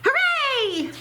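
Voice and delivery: high squeaky cheer; mouse cheers. A narrator's reading.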